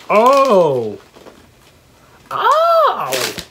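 loud high surprised oh; delighted gasp. Real, not acted. Amazed at personalized M and M's, surprised oh. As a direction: let the surprise burst out.

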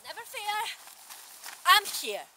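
loud, bold hero proclamation; heroic announcement. Acted. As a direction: arrive like a confident superhero. Hero arrives to the rescue.

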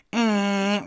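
high, loud honking blare; air horn imitation. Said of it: "really, really loud".

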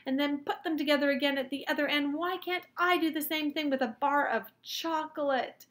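loud, high-pitched insistent argument; shouted. A narrator's reading. Boy arguing chocolate could be sent like photographs, shouted. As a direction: push it out loud and high, easing off at the end. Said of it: "I shouted".

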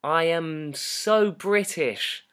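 hammed-up British, each word drawn out and loud; exaggerated British accent. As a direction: lay the British accent on thick and slow. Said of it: "today I was like".

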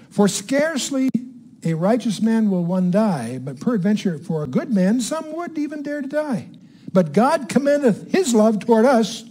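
slow, measured Bible reading in separated phrases; emphatic scripture reading. Acted. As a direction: read the verse out deliberately, phrase by phrase. Reads scripture aloud to teach.

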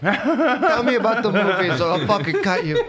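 high-pitched mock threat; squeaky knife-wielding thug; menacing high voice. Imitating knife-wielding host.

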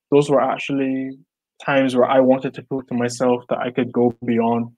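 ordinary talk

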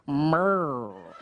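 low growled suggestive murr. Shown as a demonstration. Son answering dad's innuendo with a low suggestive murr. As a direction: drop low and purr it rudely. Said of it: "you have to go".

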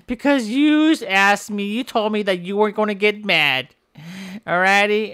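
ordinary talk, nothing marked

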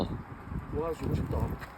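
whispering. Real, not acted. Admiring something quietly.

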